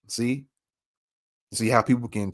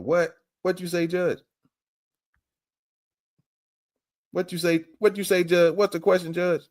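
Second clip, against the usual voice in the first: high, playful prodding questions; egging on; 9 semitones higher than usual. Acted. Reactor asks judge to repeat the question, high-pitched goading. Prompts judge before replaying clip.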